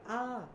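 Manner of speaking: sudden high, loud mock scream; startled falling cry, acted; yelp of slipping. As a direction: yell as if your feet slip. Acting out slipping over.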